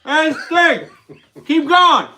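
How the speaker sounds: lightly voiced Joe Biden losing the thread; mock Biden stumbling through a debate; Joe Biden impression. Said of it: "It's my Biden wig".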